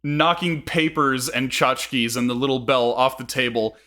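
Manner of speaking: ordinary delivery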